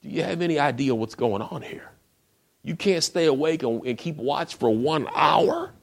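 imitating an exasperated leader who can't believe it; incredulous reproach. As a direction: chide sleepy friends, rising in pitch. Jesus chides the sleeping disciples.

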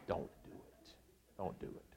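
hushed, urgent aside to the men; whispered warning. Real, not acted. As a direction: drop to a whisper, man to man.

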